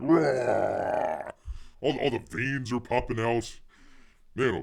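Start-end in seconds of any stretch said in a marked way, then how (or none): 0.0-1.3 a playful imitated werewolf roar; mock roar. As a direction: growl it like a cartoon beast. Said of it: "He's like".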